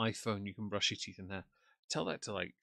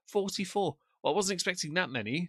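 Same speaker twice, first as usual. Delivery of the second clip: surprised; 7 semitones higher than usual. Real, not acted. Seeing the viewer count.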